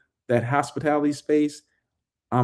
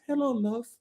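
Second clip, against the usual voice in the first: soft, high British accent imitation; imitated British accent; 9 semitones higher, 7 dB quieter than usual. Host recalling the singer's greeting, imitated British accent. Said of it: "her, you know, British accent".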